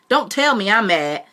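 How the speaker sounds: sharp, loud rebuke from the aunt; aunt scolding the boy who talks back; angry aunt. Acted.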